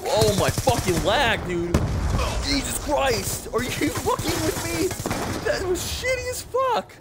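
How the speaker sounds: loud, drawn-out complaint rising to a shriek; real high-pitched gamer rage; exasperated outburst. Real, not acted. Outburst over game lag.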